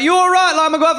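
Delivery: high, chirpy hello; high cheerful greeting. Retold or imitated. Townsfolk greeting Gareth cheerfully, high chirpy voice. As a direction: greet him brightly up high.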